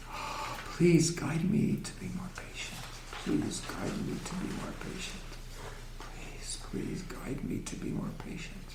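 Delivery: soft, whispered private prayer; great gentleness. Shown as a demonstration. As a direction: plead very quietly, almost without voice. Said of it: "one utters the phrase with great gentleness".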